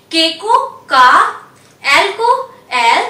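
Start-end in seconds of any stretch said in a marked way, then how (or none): 0.9-1.1 का said "kaa"; का with open "ah", not "kay"; French letter K. Shown as a demonstration. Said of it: "pronounce किया जाता है".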